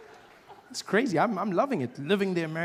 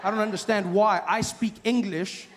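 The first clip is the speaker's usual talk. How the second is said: ordinary delivery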